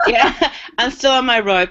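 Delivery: laughing through the words; talking through a laugh. Real, not acted. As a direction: giggle it out, a bit embarrassed. Amused about being in her robe.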